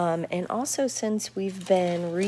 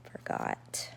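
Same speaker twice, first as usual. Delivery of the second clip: whispered aside; 10 dB quieter than usual. Real, not acted. Teacher's aside to herself.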